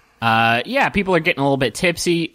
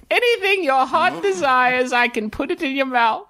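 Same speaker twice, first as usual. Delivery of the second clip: high-voiced, showy dwarven vendor; eager high-pitched stallholder patter; dwarven fried-food conjurer; 12 semitones higher than usual. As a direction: pitch your magical fry booth in a high voice.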